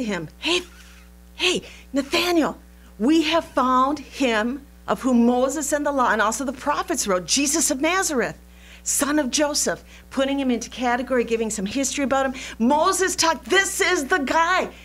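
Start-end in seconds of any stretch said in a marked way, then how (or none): 0.4-9.8 eager, raised-pitch call to a friend; excited friend. Acted. Philip excitedly telling Nathanael. 12.6-14.7 high, louder eager exclamation; this is the guy, thrilled; excited. Acted. Acting Philip's excitement.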